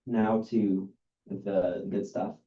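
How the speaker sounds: ordinary talk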